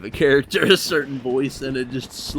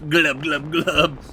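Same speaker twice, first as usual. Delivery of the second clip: glub bubble noise; 2 semitones higher, 3 dB louder, slower than usual. Pretend. Imitating a blobfish.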